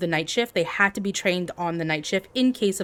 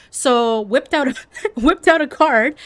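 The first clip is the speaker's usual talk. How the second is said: laughing; 6 semitones higher, 6 dB louder than usual. Real, not acted. Laughing while telling it.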